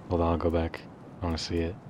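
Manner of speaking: ordinary talk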